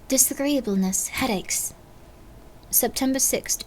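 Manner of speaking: ordinary talk, nothing marked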